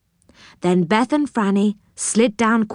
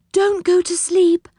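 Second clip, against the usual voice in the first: high, urgent cry; high-pitched alarm, at normal pace; 10 semitones higher than usual. A narrator's reading. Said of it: "cried Silky".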